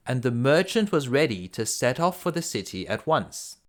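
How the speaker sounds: plain reading voice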